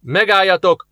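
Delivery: high, commanding shout; shouted challenge. A narrator's reading. Guard leader orders the approaching strangers to stop, shouted. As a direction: shout a halt to intruders. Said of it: "kiáltott rájuk a katonák vezetője".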